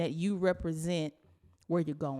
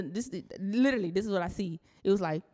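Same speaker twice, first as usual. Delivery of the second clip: normal talking voice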